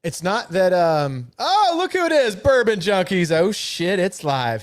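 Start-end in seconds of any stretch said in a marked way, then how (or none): 1.4-4.6 sudden high-pitched delighted outburst; real surprise at who just joined; excited greeting. Real, not acted. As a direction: jump high on "oh", then ride down excitedly. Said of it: "so excited for this".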